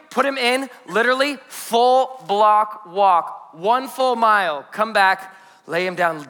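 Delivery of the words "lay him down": normal talking voice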